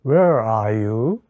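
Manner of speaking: slow, careful imitation of "Where are you?" with a rising end; repeating after the native speaker. Shown as a demonstration. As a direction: echo the model line slowly. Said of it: "들으면서 따라해 보겠습니다".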